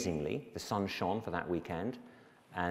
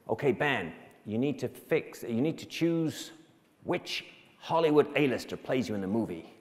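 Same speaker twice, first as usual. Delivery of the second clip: imitated American producer, pitched up; American accent; 5 semitones higher, 5 dB louder than usual. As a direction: give instructions as an American woman producer. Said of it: "lady with an American accent".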